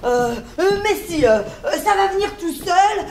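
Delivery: ordinary talk, nothing marked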